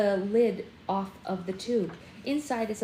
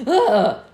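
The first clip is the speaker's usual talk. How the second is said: startled yell; 15 dB louder than usual. Acted. Peeta jolts awake, startled.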